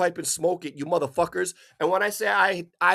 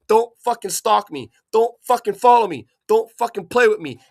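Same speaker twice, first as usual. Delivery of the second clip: furious warning shout; 3 semitones higher, 7 dB louder than usual. Real, not acted. Shouting a warning at the neighbour watching him, furious. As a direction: bark it at the man stalking you.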